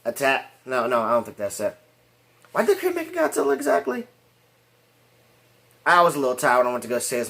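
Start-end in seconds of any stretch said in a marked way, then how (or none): 2.5-4.1 much higher, questioning voice; puzzled self-questioning. Real, not acted. Reviewer asking himself about a forgotten plot point, high and puzzled. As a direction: wonder aloud in a high-pitched voice. Puzzled aloud after losing the thread.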